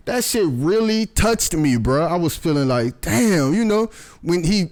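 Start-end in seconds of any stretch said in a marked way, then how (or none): none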